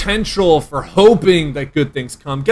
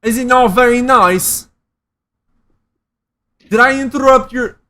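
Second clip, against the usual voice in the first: mock British accent; 6 semitones higher than usual. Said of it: "I can't do a British accent".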